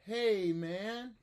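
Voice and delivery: student echoes back; small student. Shown as a demonstration. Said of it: "I'll be a small student".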